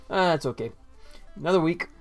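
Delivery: usual speaking voice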